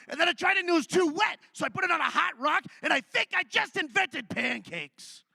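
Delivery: high, loud, manic ranting; frantic shouting. Acted. Wheat inventor yelling his breakthrough to his wife. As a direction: yell it like a man possessed by his invention.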